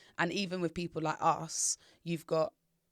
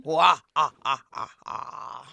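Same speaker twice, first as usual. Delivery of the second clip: evil cackle; 4 semitones lower, 6 dB louder, slower than usual. Acted. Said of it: "Do your best evil laugh".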